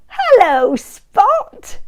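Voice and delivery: high, cheery little-monkey voice; lightly voiced friendly Steve; Steve's bright greeting. A narrator's reading. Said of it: "said Steve".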